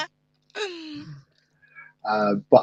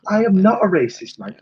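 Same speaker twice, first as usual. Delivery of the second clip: mock indignation; 8 semitones higher than usual. Mock protest at being called racist.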